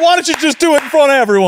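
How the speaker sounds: high, loud and fast goading; teasing agent voice. Pretend. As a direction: egg them on like a nosy guard. Playing TSA agents teasingly.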